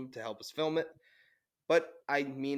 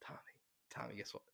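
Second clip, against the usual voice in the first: conspiratorial whisper; 3 semitones lower, 16 dB quieter, faster than usual. Retold or imitated. Dad sneaking a joke to son.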